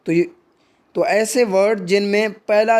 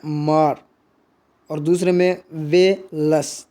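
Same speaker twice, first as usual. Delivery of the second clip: मार stressed, वे लस light and drawn out; syllable-by-syllable stress demo; syllables split; 3 semitones lower, slower than usual. Shown as a demonstration. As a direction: break the word into syllables, mar heavy. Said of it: "उसमें stress दिया जा रहा है".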